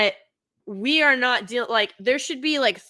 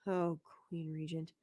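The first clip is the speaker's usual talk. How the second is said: quiet aside; 7 semitones lower, 15 dB quieter than usual. Real, not acted. Reading a name quietly.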